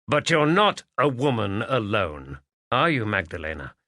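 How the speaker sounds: ordinary delivery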